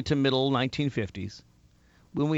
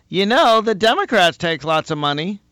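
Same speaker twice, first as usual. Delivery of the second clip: imitating the Thanksgiving uncle, higher and louder; mock the know-it-all uncle; 5 semitones higher, 6 dB louder than usual. Host mocking the uncle at Thanksgiving, higher and louder.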